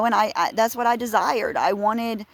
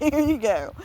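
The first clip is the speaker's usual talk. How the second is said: higher, giggling, lightly voiced God; mock God handing over; 8 semitones higher than usual. God handing the Israelites Saul, mock giggly and higher. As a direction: tease it out like giving in with a laugh. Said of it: "you know, like".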